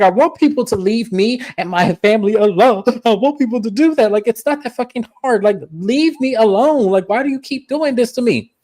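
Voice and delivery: mock upset outburst. Retold Brahma's outburst.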